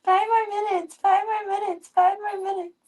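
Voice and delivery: very high, squeaky begging voice; high repeated whining; whiny kid plea. Pretend. Voicing the cat's plea.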